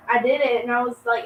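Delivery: ordinary delivery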